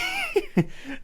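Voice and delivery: high breathy cackle; mad giggle. Acted. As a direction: giggle like a lunatic. Unhinged boss laughs.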